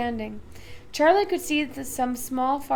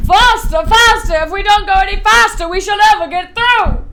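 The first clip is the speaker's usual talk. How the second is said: loud, higher, urgent cries; excited shouting; 7 semitones higher, 17 dB louder than usual. A narrator's reading. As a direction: push it, push it. Wonka urging elevator, banging wall.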